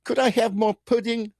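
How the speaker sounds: put-on high, begging English child voice; piping little-kid English accent; little British kid. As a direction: ask like a hungry little urchin. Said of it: "like a little kid pop-up going".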